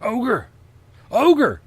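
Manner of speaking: high, loud mock panic cry; over-the-top panicked yell; hammed-up yelling. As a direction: yell the alarm like a panicked teacher. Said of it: "he yelled".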